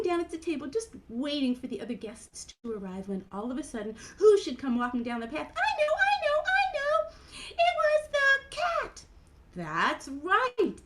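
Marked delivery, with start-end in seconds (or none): 5.5-9.0 eager high puppet voice; Mimi. Acted. Mimi guesses the next guest, eager high puppet voice. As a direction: burst in as a tiny excited character.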